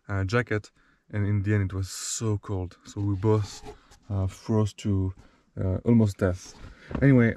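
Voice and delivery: soft, drowsy early-morning voice; sleepy voice. Real, not acted. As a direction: talk like you just woke up. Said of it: "sleepy voice in the morning".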